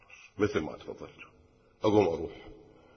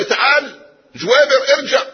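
much higher and louder, shouting after him; calling him back; 6 dB louder than usual. Acted. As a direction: call out to a man walking away. Father calls the departing suitor back.

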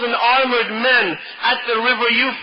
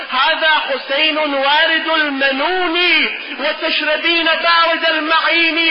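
Arabic verse recitation; 4 semitones higher than usual. Retold or imitated. Flag bearer rebuking his own soul at the river, verse recitation.

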